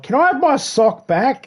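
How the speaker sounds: high-pitched indignant demand; mock indignant at the cat. Real, not acted. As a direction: whine at a pet to give it back. Scolding the cat over a sock.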